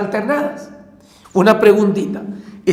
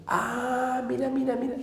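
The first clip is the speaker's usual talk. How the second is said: hushed aside; 4 semitones higher, 12 dB quieter than usual. Real, not acted. Teacher hushes to the class before revealing a trick. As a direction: drop to a hushed, softer voice.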